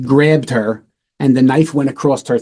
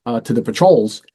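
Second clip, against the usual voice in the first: normal talking voice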